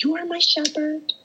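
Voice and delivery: high, fervent recited prayer; shouted psalm. Retold or imitated. Praying Psalm twenty-three aloud to God, high and fervent. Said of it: "shouting Psalm twenty-three".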